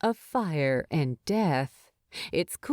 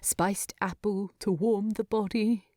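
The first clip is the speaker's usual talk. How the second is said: ordinary delivery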